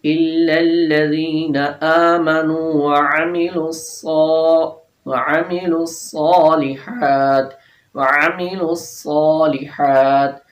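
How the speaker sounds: chanted الصَّالِحَاتِ with a thick, hard ص; heavy sad recitation. Shown as a demonstration. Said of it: "موٹا کرنا ہے اس کو اور سختی".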